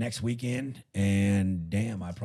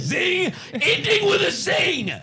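high, loud triumphant zing; excited zing; 8 dB louder, slower than usual. Real, not acted. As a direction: belt out the zing like a punchline. Ending flourish, loud high.

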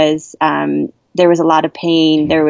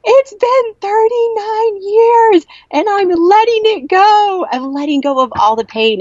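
elated shout. Retold or imitated. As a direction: announce your freedom to strangers. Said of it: "I looked at them and I was like".

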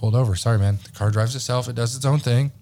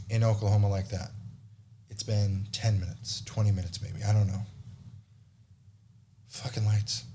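hushed; 8 dB quieter than usual. Retold or imitated. Quiet relaxed cruising.

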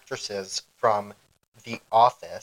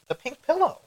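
high squeak. Acted. Cute show name introduction.

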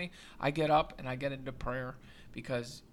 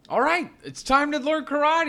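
put-on much higher, louder eager kid voice; eager Daniel; 12 semitones higher, 13 dB louder than usual. Daniel declaring he's ready to learn karate, high and loud. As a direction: announce it like an impatient student. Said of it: "He's like".

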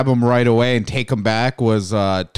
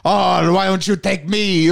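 high pleading toy voice; toy character voice; 7 semitones higher than usual. Retold or imitated. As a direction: beg like a little toy soldier. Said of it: "making little impressions of him".

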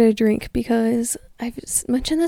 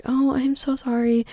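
higher, drawn-out innocent apology; put-on sweet innocence; playing innocent; 4 semitones higher than usual. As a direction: apologize like a clueless young mom, slowly. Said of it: "play it as the, like, innocent, young, innocent mom".

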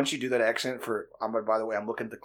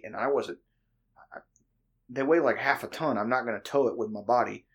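usual speaking voice

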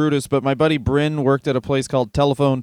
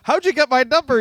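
imitating a confused customer; 9 semitones higher than usual. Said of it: "And he's like".

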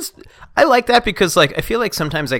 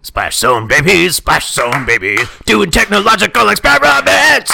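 gruff throaty yell; 6 semitones higher, 6 dB louder than usual. Acted. Babies who could make the phone work, gruff shouted jingle. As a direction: yell till it hurts the throat. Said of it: "that actually really hurts my throat".

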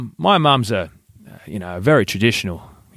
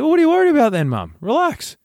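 very high-pitched retold protest; mock exasperation; faster than usual. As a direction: brush off mum's worry, voice shooting up. Retold exasperated son reassuring mum.

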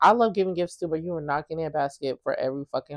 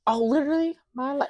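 mocking echo; 8 semitones higher than usual. Echoing co-host's words mockingly.

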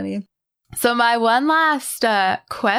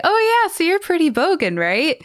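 much higher, chirpy retold remark; lightly mimicked, high-pitched small talk; breezy newcomer; 9 semitones higher than usual. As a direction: imitate someone casually trying out new slang. Retells dad's breezy remark to customer.

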